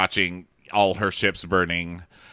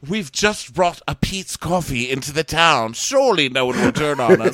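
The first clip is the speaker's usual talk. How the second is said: higher put-on character voice; hammed-up townsperson, pitched up; lightly voiced townsperson; 7 semitones higher, 5 dB louder than usual. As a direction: play a skit character, voice lifted. Acted townspeople in coffee skit.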